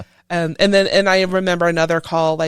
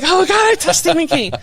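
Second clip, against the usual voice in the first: starstruck squeal; 11 semitones higher, 6 dB louder than usual. Retold or imitated. Retelling brushing against Stephen King.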